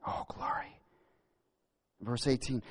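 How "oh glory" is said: whispered praise. Real, not acted. Whispered high exclamation.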